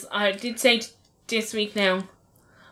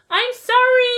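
put-on high, loud singsong sorry from a naughty kid; mother mimics daughter apologising while misbehaving; mocking cheeky child; 12 semitones higher, 7 dB louder, much slower than usual. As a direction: imitate a kid faking an apology. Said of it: "say sorry, like, while she's doing it".

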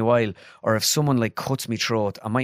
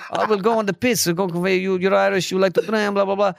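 much higher imitation of a player; mocking Irish lad; 8 semitones higher than usual. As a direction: mimic the lad taking the mickey. Caller retold, higher voice.

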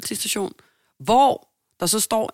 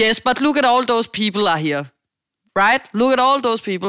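higher and louder, pointing it out impatiently; irritated receptionist; 5 semitones higher, 6 dB louder than usual. Retold or imitated. Receptionist insisting the room is full, loud impatient English. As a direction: scold her toward the crowded room. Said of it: "Og så var hun sådan".

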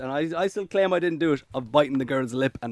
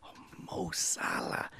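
breathless whisper of the name; 15 dB quieter, slower than usual. Pretend. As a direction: whisper it like a crush. Dreamy answer naming Salah.

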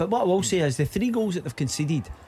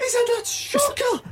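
very high, drawn-out cry of disbelief; real match-watching outrage; outraged exclamation; slower than usual. Real, not acted. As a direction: exclaim slowly at a disputed decision, pitch soaring. Outrage at disallowed goal during match.

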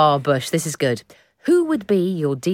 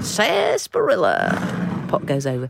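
slow, drawn-out mock thespian; lightly voiced old stage ham; hammy stage actor; 3 semitones lower than usual. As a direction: stretch the word out like an old actor. Mimicking old stage actor on telly.

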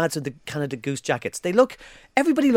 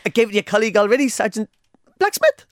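fast Geordie line, voice shooting up on Blacksmith; panicked Geordie read, squeaking up on the last word; higher, louder Geordie accent forced into a question; strained Geordie accent; 5 semitones higher, 3 dB louder than usual. Retold or imitated. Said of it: "the inflection at the end was the".

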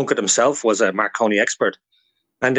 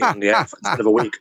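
usual speaking voice

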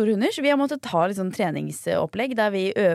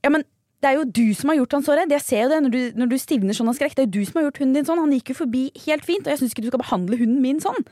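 mildly heated accusation, raised a little; retold scolding; 4 semitones higher, faster than usual. Dog owner blames her for the scared dog, retold scolding. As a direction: press the complaint as a mock-indignant dog owner.

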